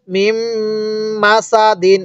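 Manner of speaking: long hummed m from মিম into মা, দিন sounded in full; joined mim. Shown as a demonstration. Teacher joining the letters for learners, long hum on মিম. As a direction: hold মিম nasally, then measured মা সা দিন. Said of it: "এই মিমের সাথে লাগাবো".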